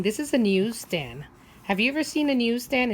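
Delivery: plain reading voice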